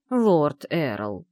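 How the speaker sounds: drawn-out scolding address; reproachful drawl. A narrator's reading. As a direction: stretch the title out in reproach. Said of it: "укоризненно протянула женщина". (укоризненно, протяжно)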